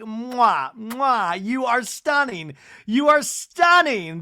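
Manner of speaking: high, slow, rising gush; gushing mock air kisses. [name] fawns over Meredith's mother, gushing. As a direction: ham up fawning compliments.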